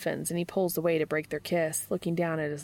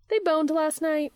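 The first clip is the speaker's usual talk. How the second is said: high-pitched gossipy blurt; Charlie; 10 semitones higher, 4 dB louder than usual. A narrator's reading. Charlie tells Jimmy about the lovebirds, high gossipy voice.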